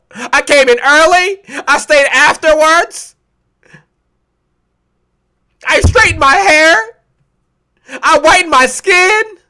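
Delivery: high, mock-bewildered complaint; whining victim. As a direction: plead it high, as if wronged and baffled. Acted victim complaining online.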